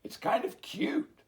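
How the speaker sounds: high, eager blurt; blurting sheep. Acted. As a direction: blurt it out sweetly. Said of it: "a sheep blurted out".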